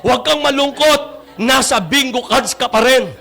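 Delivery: high, lilting preaching over music; sermon delivery. Acted. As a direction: reassure the church, preacher style.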